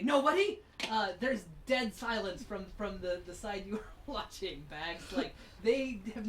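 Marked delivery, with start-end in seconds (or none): 0.0-0.6 higher, louder questioning blurt; a bad bluff from the shadows; ruffian's voice. Game master voicing a hidden ruffian, raised questioning voice. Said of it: "you hear a voice say".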